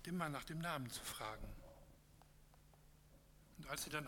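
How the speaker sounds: measured storytelling read to a congregation; story narration. A narrator's reading. Pastor reading a parable to the congregation, calm storytelling.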